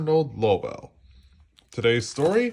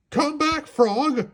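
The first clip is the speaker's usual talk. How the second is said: desperate shout; slower than usual. A narrator's reading. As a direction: shout after a friend who vanished. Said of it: "he shouted".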